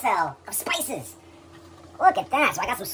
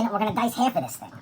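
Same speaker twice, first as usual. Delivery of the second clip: normal talking voice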